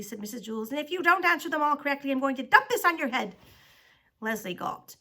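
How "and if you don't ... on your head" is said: loud, building threat; threatening. A narrator's reading. Teacher warning Leslie about wrong answers, threatening. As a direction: deliver the warning forcefully, quick then emphatic.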